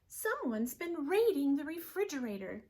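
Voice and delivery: an outraged mother bear; Missus Blair. Acted. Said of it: "said Missus Blair".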